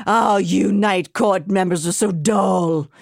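slow, sneering villain drawl; lightly voiced bored villain; villain talk. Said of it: "general villain talk".

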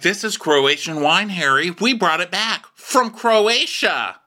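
high, hammed-up announcement, slowing at the end; showy presenter. Boy toy presenting wine to Harry, showy announcing. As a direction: present it grandly, pitch climbing.

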